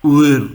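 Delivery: "Uhr" said as a drawn-out "oo-er"; German pronunciation. Shown as a demonstration. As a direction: stretch the oo in "Uhr". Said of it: "So German word".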